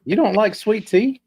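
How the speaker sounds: can't believe what you're hearing; shocked disbelief. Real, not acted. A friend appalled that he dislikes sweet tea, slow incredulous question.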